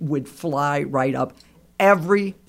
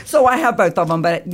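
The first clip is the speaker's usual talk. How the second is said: speaking through laughter; giggling delightedly about her birds; laughing; 5 dB louder than usual. Real, not acted. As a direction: let the laugh carry the words.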